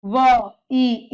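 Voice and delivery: व and ई held apart; unhurried phonetic demonstration; slow sounding out. Shown as a demonstration. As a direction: say each sound slowly on its own. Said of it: "अब धीरे धीरे बोलिए".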